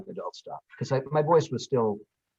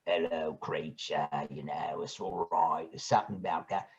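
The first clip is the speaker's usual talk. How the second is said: Australian accent; 9 semitones lower, 8 dB quieter than usual. Shown as a demonstration. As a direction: drop deep and quiet into Australian. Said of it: "I love doing, like".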